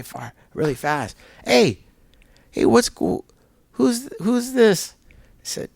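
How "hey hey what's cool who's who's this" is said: much higher, urgent calling out; a buddy racing up, higher and excited; excited friend. Retold or imitated. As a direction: call out like you just ran over with news.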